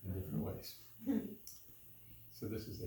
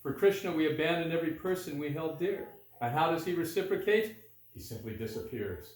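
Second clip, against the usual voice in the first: higher, louder recitation that drops at the end; gopis' complaint in verse; 6 semitones higher, 6 dB louder than usual. A narrator's reading. Gopis complaining about Krishna, raised verse recitation. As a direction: build the grievance, then let it fall away.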